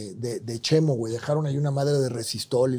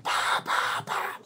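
popped mouth-made shots, softer; imitated gunfire; 6 dB quieter, much slower than usual. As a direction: mimic firing after fleeing men. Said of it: "les empecé a tirar".

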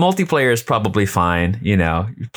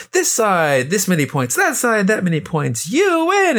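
rising hype, much higher at the end; announcer voice; 12 semitones higher than usual. Retold or imitated. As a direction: build up to a big "you win". Imitating game results announcer.

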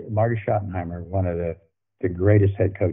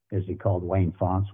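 normal talking voice

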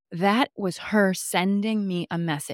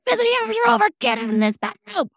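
much higher, loud bossy voice; mock stern order; 5 dB louder, faster than usual. Mom orders the spirits out, high mock-bossy voice. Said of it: "I was like".

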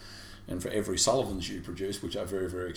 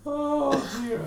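laughing oh dear; 5 dB louder than usual. Real, not acted. Reaction to the pick.

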